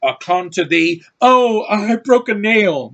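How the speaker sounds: hammed-up, high, loud whine over a trifle; mock women action heroes fussing; prayer breaking into a drawn-out squeal of dismay; mock girly complaint. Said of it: "They'd be like".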